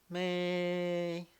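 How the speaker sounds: MAY held high and level; first tone. Shown as a demonstration. Teacher modeling the first tone for students, MAY high and level. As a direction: draw out MAY on one high, level pitch. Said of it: "第一声是咪".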